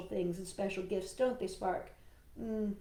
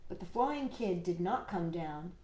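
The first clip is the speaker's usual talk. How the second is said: ordinary delivery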